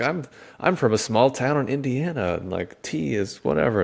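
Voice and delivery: lightly voiced excitement about his small hometown; excited explanation. Explaining his small-town roots to tea sellers, mildly excited. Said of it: "excited 'cause I'm like".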